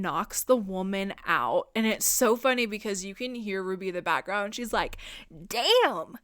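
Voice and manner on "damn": loud, slow hyped-up cheer. Retold or imitated. Ruby cheering as Alice knocks the woman out, loud. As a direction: egg her on from the sidelines.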